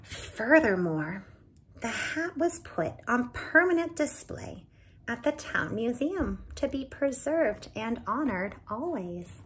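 put-on lively read-aloud for children; animated storyteller voicing each character; story narration. A narrator's reading. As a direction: tell it like a bedtime storybook. Reading picture book aloud.

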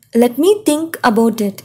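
slow, clear, each word set apart; slow model phrase. Shown as a demonstration. Teacher modeling a phone phrase for students, slow and clear. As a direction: spell it out slowly for learners. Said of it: "teacher models English phrase".

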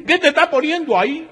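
very high, loud mock voice; alarmed question. Chinese prisoner asks his cellmate, very high and loud. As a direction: ask in sudden alarm.

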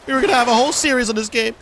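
high, fast half-sobbing lament; hammed-up crying over the lost series; mock crying. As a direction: whine like a crying child.

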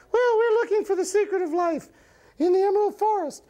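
falsetto puppet voice; high cartoon sidekick. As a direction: speak up in a tiny, very high register. Mister Goop answers Mister Turtle.

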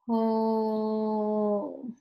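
"Pole" held very long on a level o; slow sounding-out. Shown as a demonstration. Teacher repeats the word after naming long O, very slow "Pole". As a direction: stretch the o right out in "Pole". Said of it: "Say it slow".

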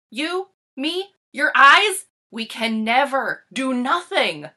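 bursting with the big news; excited discovery. Acted. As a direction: kid shouting out a world-changing idea. Big discovery about nothing.

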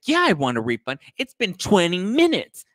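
high, loud customer imitation; hammed-up high outrage, slowing at the end; mock indignant demand. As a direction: act out an entitled complaint. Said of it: "he's going off on her".

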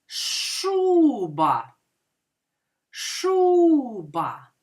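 long held ШУ in Шуба; stress on first syllable. Shown as a demonstration. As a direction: stretch ШУ, then drop onto ба in Шуба. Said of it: "І визначимо наголос".

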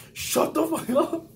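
high, slow, mocking chief voice; chief snapping. Chief telling a scared recruit to shut up: high slow mock snap. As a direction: snap it like an annoyed instructor.

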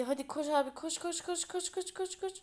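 rising frantic urging; being chased. Real, not acted. Urging a run in game.

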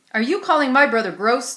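a bully squaring up to her; demanding. A narrator's reading. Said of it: "demanded Braden".

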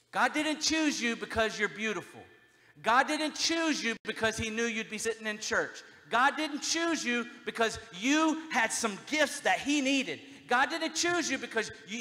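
loud, high preaching climax; preaching at full force. Real, not acted. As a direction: build it to a shouted peak.

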